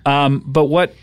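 ordinary talk

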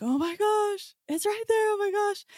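very high, breathless excited cry; excited yelling. Retold or imitated. Spotting the red orb over the tree, high excited squeal. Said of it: "I just started yelling".

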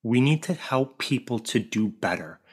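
ordinary talk, nothing marked

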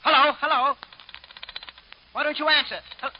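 loud, high calling into the phone. Acted. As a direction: shout to get an answer. Calling into dead phone line.

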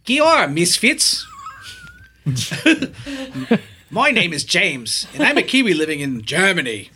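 put-on a light, laughing try at a New Zealand accent; attempted Kiwi accent. [name] reading a Kiwi listener's letter in a Kiwi accent. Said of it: "not gonna be able to do the, uh, accents".

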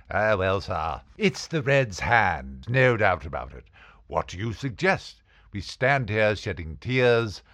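lightly put-on theatrical old-fashioned voice; girlfriend's put-on voice. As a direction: answer grandly, teasing. Girlfriend replies in odd voice.

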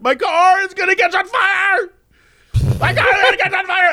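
mock panicked shriek about his car; hammed-up panic, shouting; panicked yell. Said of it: "he was like".

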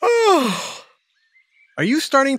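slow words stretched by a yawn; talking through a yawn. Real, not acted. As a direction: yawn into the question. Yawning mid-sentence about yawning.

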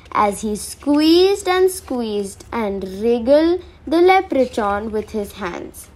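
plain reading voice

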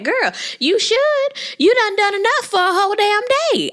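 sassy scold. Pretend. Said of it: "And I'm like".

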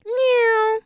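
high loud cat meow. Acted. Cat noise.

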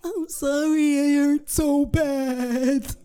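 put-on high, stretched childlike wail; mock-tearful child, slow and high; caught five-year-old. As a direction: whine it out like a hurt little kid. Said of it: "I'm the five-year-old caught with the cookie".

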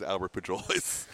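Pujols as "poo-JOE-leez"; quoting the mangled Pujols, "pujolees"; butchered name. Retold or imitated. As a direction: say Pujols with a J where the H belongs. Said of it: "whose name he butchered".

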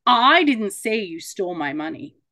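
emphasis on I at the very start; stress on I. Shown as a demonstration. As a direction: lean hard on the opening word "I". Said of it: "which word you actually put emphasis on".

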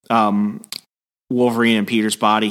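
usual speaking voice

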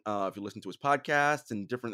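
ordinary talk

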